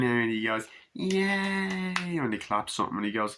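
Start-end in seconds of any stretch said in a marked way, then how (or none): 0.9-2.2 high, drawn-out mock kid cheer; child's cheer. Small boy clapping, high imitated child cheer. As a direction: imitate a little boy's cheer.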